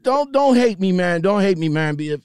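ordinary delivery

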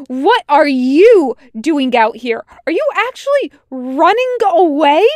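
high, very loud, incredulous questions; indignant shout. A narrator's reading. Tucker asks if Wash is running away, shouting. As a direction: shout in outraged disbelief. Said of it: "Tucker shouts back".

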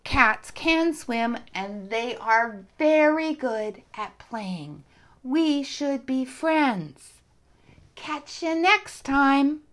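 loud, slow friendly call. Acted. Kata calling down to the ducks, loud and slow. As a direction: call it down slowly so they hear. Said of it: "she called down to them".

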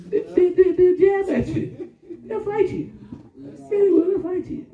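high, laughing delivery. Real, not acted. Preacher laughing about fighting cats, high. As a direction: laugh through the point.

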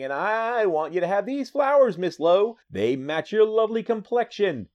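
high-pitched hammed-up suitor; Clark Kent. Reporter giving flowers to Miss Lowe, high acted gallantry. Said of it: "Clark Kent going".

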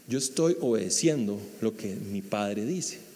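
Jesus calmly answering John; gentle Jesus. Retold or imitated. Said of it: "lo que Jesús le dice es".

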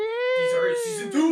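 hammed-up teary hype; mock dramatic. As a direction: cry it out like an overblown trailer. Hyping a bigger season.

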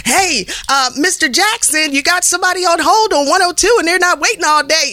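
high, cheeky announcer page; cheeky loudspeaker page. Retold or imitated. Receptionist clowning over the station loudspeaker, high and cheeky. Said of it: "I would just do stuff like this over the loudspeaker".

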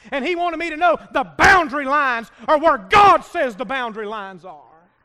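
loud, driving preacher's climb; preaching at full force. Real, not acted. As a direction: push it up to a loud climax.

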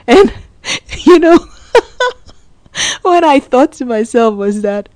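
talking through real laughter; laughing. Real, not acted. Mom laughing as she recalls her son's confession, laughing through words. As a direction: let the laugh spill over the words. Laughing while telling the story.

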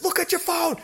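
high-pitched mock yell, held back in volume; urgent, high imitation of shouting; retold yelling. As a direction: act out yelling at someone who can't hear. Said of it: "I'm yelling at the stream".

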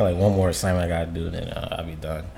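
ordinary delivery

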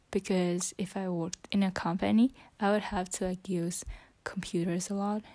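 whispering. Real, not acted. Whispering her English practice into the phone. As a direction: keep it to a whisper throughout.

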